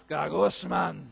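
Gagusman in a low, grumbling groan. Pretend. Preacher acting out a complainer, low grumble. Acted complaint about wife.

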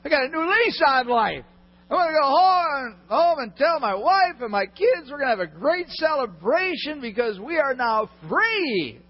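high, loud jubilant cry; overjoyed servant. Acted. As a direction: shout with the joy of a freed man. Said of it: "Can you imagine that guy ... jumping all around".

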